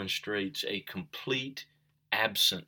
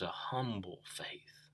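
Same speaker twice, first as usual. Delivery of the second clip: whisper; 12 dB quieter, slower than usual. Real, not acted. Preacher drives home lesson.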